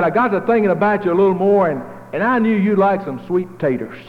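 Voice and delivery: a friendly neighbor at the door, slightly lifted. Retold or imitated.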